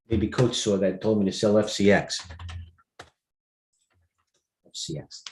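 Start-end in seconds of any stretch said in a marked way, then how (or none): 3.0-5.2 slow breathy whisper of the ticker. Real, not acted. Host reading his chart, whispering to himself. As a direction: breathe the letters out slowly under your breath.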